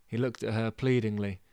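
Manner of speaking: usual speaking voice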